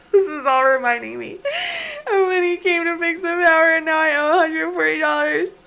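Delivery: high, loud, crying complaint; tearful wail. Real, not acted. Player reminded of the repair bill, tearful wail. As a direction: wail it through laughing sobs. Player wails over a costly memory.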